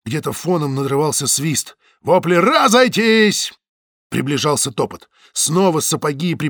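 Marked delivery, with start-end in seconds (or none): 2.4-3.5 slow, loud higher shout; shouted order. A narrator's reading. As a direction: bellow at the crowd to disperse. Guards shouting at crowd.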